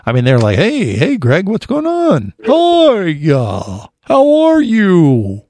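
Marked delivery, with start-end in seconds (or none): 0.6-2.3 high, slow, drawn-out turtle greeting. Retold or imitated. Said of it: "I like your turtle voice". 2.4-3.9 very slow, stretched turtle greeting. Retold or imitated. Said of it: "I like your turtle voice". 4.0-5.4 turtle voice. Retold or imitated. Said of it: "I like your turtle voice".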